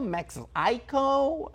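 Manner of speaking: "Mexico" slow, high, as "Mexaico", rising; unsure Mexico. Shown as a demonstration. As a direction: ask "Mexico?" as "Mex-eye-co". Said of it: "not knowing how to pronounce".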